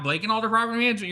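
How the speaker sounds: a quick brand plug; fast hyped brand tagline. Retold or imitated. Host reciting his company tagline in a fast rush. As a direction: rattle off the company name quickly. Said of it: "you're gonna hear that".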